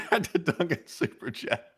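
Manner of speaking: laughing. Real, not acted. Laughs getting back on track.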